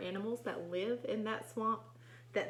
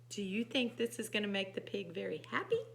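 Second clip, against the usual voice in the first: ordinary delivery, nothing marked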